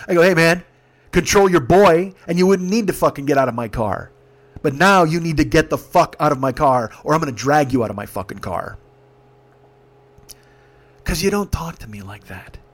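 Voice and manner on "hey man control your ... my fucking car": retold angry order. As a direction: lay down the law as the fed-up driver. Teller orders passengers out, retold.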